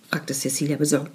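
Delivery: ordinary delivery, nothing marked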